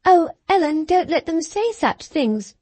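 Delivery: put-on high, distressed girlish plea; mild distress. A narrator's reading. Cathy begs Ellen, high troubled plea. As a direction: plead with Ellen, upset. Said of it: "she pursued in great trouble".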